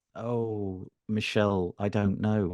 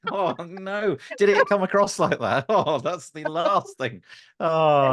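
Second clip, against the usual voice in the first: embarrassed oh no; 9 semitones higher, 4 dB louder than usual. Real, not acted. Worried he sounded arrogant.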